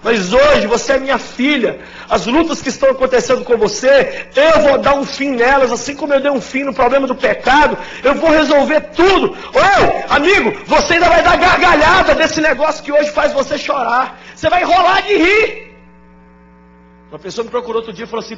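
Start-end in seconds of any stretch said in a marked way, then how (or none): 0.0-9.3 loud, high, urgent preaching; full-force preaching. Acted. Pastor exhorting the grieving congregation, loud and fervent. 9.3-10.1 shout. Real, not acted. Preacher crying out. 10.1-15.8 loud, high, urgent preaching; full-force preaching. Acted. Pastor exhorting the grieving congregation, loud and fervent.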